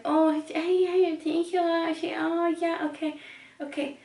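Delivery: high caricature of a confused clerk; mock clerk. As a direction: ham up a flustered shop girl. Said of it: "she's like".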